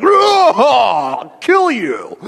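high yelled oh then a threat; a driver stuck in traffic, mock furious; mock road rage. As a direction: play a driver snapping in traffic.

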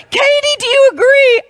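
put-on high, loud eager child asking; little boy's voice. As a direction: voice the little grandson, insistent. Seth asks his sister.

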